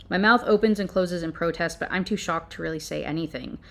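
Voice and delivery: ordinary talk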